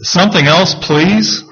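high-pitched, mock pleading request; pleading Habakkuk. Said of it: "what Habakkuk is saying here".

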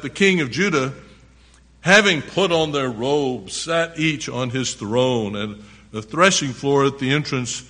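emphatic, projected Bible reading; preacher reading scripture to the congregation. A narrator's reading. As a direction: read it out loud, punching key words. Reads scripture aloud, forcefully.

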